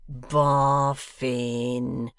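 hoarse whisper through a keyhole; through a door. A narrator's reading. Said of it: "he called through the keyhole".

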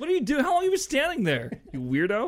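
high, hammed-up freaked-out questioning; retold startled alarm. Dad to daughter standing by bed, high mock alarm. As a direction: act out a rattled dad demanding answers.

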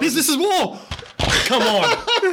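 high, laughing protest. Real, not acted.

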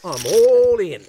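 a hammed-up Aussie bluffer, Australian accent; bad Australian accent. Player bluffing at the poker table, bad fake Australian accent. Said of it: "I know my accent sucks".

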